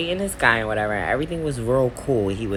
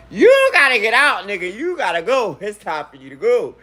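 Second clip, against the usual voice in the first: high, loud scolding; bitching him out; 7 semitones higher, 3 dB louder than usual. Retold or imitated. Ordering the boyfriend to leave her house, scolding. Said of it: "I'm bitching".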